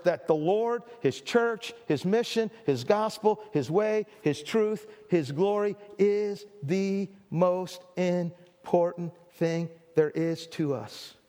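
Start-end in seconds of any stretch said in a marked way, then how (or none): 1.8-11.1 very slow, lower, word by word; deliberate, spaced-out preaching, dropping at the end; slow, weighty emphasis. Real, not acted. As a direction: let each word land separately, sinking lower. Preacher spells out his climax word by word.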